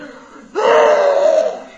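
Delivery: loud high gasping cry; shocked gasp. Acted. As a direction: choke on the sweetness with a shriek. Neighbour tastes sugary coffee.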